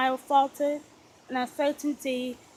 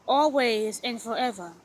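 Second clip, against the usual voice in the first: ordinary delivery, nothing marked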